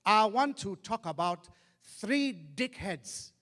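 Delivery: slow, weighty speech-maker voice; Mandela impression. Statesman opening his first speech after prison, slow oratory. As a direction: address the crowd slowly, spacing the words. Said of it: "He came out, his opening speech, he went".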